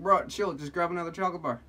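exasperated imitated plea; mock exasperation. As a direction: urge him loudly and fast. Acted reply to angry customer.

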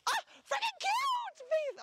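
very high, slow delighted squeal; high squealing praise; thrilled squeal. Real, not acted. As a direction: let the delight spill over. Photographer thrilled by a pose.

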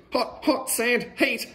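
squealing about the heat; hot-sand squeal. A narrator's reading. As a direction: hop about on burning sand. Feet burning on sand.

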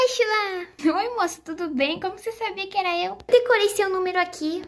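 put-on high childish voice on the phone; high, chirpy kid voice; little girl voice. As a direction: play a cheerful little girl answering a call. Vlogger voices doll Ashla on phone.

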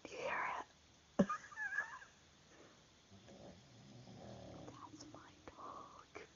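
hushed whisper so the dog sleeps on; breathless whisper so as not to wake him. Real, not acted.

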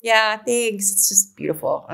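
ordinary delivery

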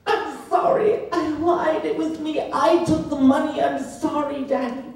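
high, laughing-crying apology; broken pleading. Retold or imitated. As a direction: beg forgiveness like a broken child. Broken boy begging father.

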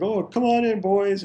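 high, cheerful welcome; lightly voiced, chipper old Ray; chipper old man. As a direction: greet them brightly like a happy old host. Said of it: "Ray was very happy and very chipper".

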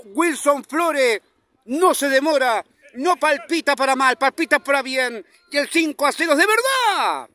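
high, loud, sing-song goal narration; goal call shout. Acted. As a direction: belt out the goal replay like a stadium announcer. Replay of the fifth goal, shouted call. (narración de gol a todo pulmón, aguda y cantada)

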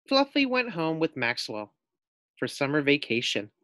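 ordinary delivery

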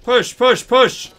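high loud repeated command; rallying shout. Real, not acted. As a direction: drive the team forward. Urging team forward.

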